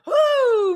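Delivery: high, loud cheer; excited whoop. Real, not acted. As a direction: whoop with delight.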